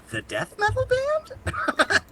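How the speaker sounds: high, slow, surprised question. Retold or imitated. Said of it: "my response when he said".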